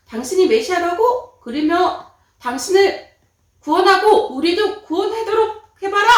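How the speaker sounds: one robber taunting Jesus, loud scornful jeer; robber mocking. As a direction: sneer the challenge. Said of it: "조롱하는 것이었어요".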